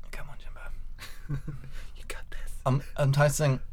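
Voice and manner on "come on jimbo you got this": slow whispered self-encouragement. Real, not acted. Tasting the wine, whispering encouragement to himself. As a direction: urge yourself on in a breathless whisper. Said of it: "whispering to himself while tasting".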